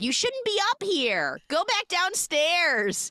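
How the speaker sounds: high-pitched scolding imitation; mock scolding old woman. Old woman sending a girl back downstairs, hammed-up high scolding. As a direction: scold up high, drawing out the final order. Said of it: "an old woman appears from another apartment".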